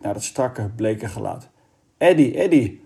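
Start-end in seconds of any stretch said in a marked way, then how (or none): 2.0-2.8 higher, louder call to someone who will not answer. A narrator's reading. Kees calls to the lifeless Eddy, loud and anxious. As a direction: call his name, urgent and scared. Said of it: "riep Kees".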